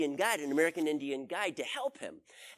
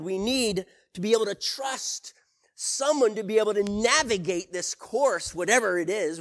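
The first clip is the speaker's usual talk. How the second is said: preaching at full force; 5 dB louder than usual. Acted. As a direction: press the congregation hard on losing our way. Preacher exhorts the nation.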